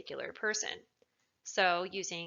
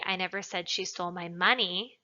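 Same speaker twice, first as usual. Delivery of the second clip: "money" stressed with a rise at the end; emphatic last word "money". Shown as a demonstration. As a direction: save the punch for "money". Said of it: "she stole something else, not the money".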